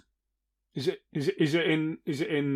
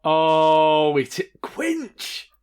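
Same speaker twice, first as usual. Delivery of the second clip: excited shout; 2 semitones higher, 8 dB louder, much slower than usual. Real, not acted.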